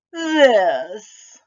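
loud rising monster reveal; spring it out like a monster; loud germ reveal. Acted.

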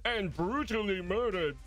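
high cartoon newsreader; hammy cartoon news read; SpongeBob news anchor. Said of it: "I'm doing the SpongeBob news anchor voice".

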